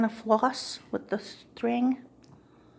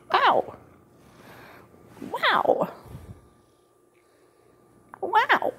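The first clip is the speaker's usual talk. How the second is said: mock amazement; 13 semitones higher, 4 dB louder, much slower than usual. Real, not acted. Reacting to the cat.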